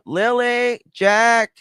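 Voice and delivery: put-on high loud calling of kids' names; sharp high call for the children; urgent calling. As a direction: call out for the kids in alarm. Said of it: "wouldn't you be like".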